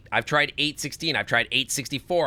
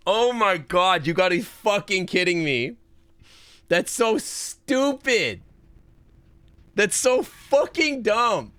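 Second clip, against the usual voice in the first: incredulous exasperation; 5 semitones higher than usual. Real, not acted. Streamer calling the puzzle stupid, incredulous exasperation. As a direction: stretch the swearing in real disbelief. Real outburst at puzzle answer.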